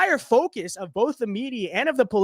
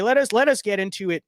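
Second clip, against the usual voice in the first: usual speaking voice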